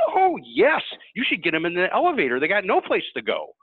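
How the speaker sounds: lightly voiced eager older lady; excited lady. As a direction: imitate the enthusiastic advocate. Lady answering, enthusiastic.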